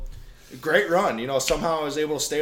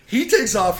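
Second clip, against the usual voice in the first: usual speaking voice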